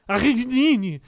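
a hammed-up Dutch accent with a stuffy nose. Shown as a demonstration. Streamer shows chat his mock Dutch accent on the hospital name. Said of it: "Ça, c'était mon accent, euh, néerlandais".

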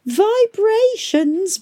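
rising, stretched-out whine; صوت متذمر ممدود; drawn-out whine. A narrator's reading.

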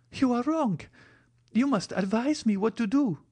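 put-on very high, urgent young woman; pleading insistence. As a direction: implore him in a high, pressing voice. Valentine begs for advice.

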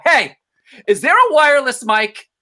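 loud, high performer's holler; retold calling out. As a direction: call across the room for help. Calling out before going on.

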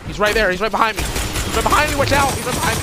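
alarmed yelling, pitch jumping up; panicking gamer. Real, not acted. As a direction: panic as the enemy closes in. Enemy right behind him, alarm.

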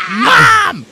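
very high, loud mock scream for Mom; imitated yell. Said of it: "he yells, Mom".

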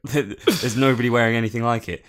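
normal talking voice

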